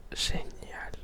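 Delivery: ordinary talk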